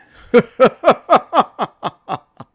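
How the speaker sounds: high, loud evil cackle; villain laugh. Acted. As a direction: cackle down at the heroes from the deck.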